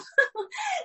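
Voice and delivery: a short, high burst of laughter. Real, not acted. Telling her church friends about the fine, high amused laugh. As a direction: laugh at her own joke.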